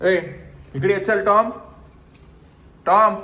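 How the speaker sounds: high, loud call to a dog; calling from far away. Real, not acted. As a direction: shout for the dog from afar. (कुत्र्याला दूरून हाक)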